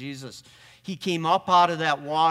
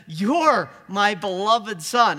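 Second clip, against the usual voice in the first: slow, louder and higher blessing; a gently lifted, slower divine voice; voice of God; 4 semitones higher, 7 dB louder, slower than usual. Retold or imitated. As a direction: bestow it slowly, like a proud father. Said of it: "and the voice says".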